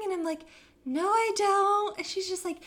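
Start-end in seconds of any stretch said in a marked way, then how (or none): 0.8-2.0 high, slow mock protest; reluctant protest. Retelling her answer to the matchmaking lady, reluctant. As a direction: drag out a reluctant refusal, pitched up. Retells resisting the ice skating.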